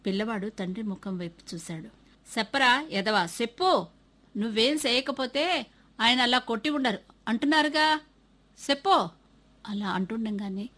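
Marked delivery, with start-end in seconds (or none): 2.3-9.1 rough scolding father. Acted. Father scolds son to speak.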